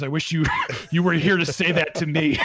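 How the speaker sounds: wistful wish broken by laughter; laughing. Retold or imitated. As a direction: laugh through a mock-touched plea. Laughs while voicing own reaction.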